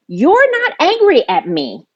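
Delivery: high, insistent pitch without extra volume; retold confrontation. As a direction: retell it as an emphatic confrontation. Acted confrontation with her brother.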